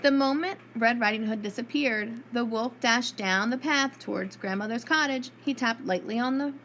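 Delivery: plain reading voice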